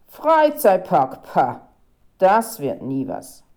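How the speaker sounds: loud, slow, scoffing disbelief; scornful grumble. A narrator's reading. Photographer scoffing at the planned park, grumbling. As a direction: dismiss it with a gruff scoff.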